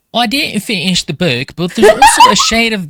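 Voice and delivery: reading in a mock accent, lightly done; Lindbergh's accent. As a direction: put on her odd accent for the quote. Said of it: "that's how she talks".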